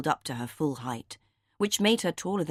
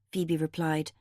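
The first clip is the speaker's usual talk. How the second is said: usual speaking voice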